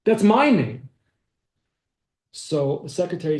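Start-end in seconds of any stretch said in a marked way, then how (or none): 0.0-0.9 higher, louder retold protest; astonished. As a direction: exclaim it in astonishment, stressing the claim. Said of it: "So Weinreb is astonished".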